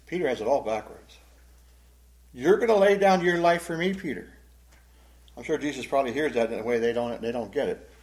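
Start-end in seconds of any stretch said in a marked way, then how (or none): 2.3-4.3 loud, high-pitched incredulous question; ironic disbelief. Acted. Jesus questioning Peter's backwards promise, ironic disbelief. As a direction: echo the offer back in disbelief. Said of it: "notice the irony in that statement".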